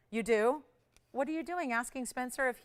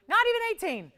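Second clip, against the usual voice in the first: excited price drop; 7 semitones higher, 8 dB louder than usual. Acted. Host dropping the price.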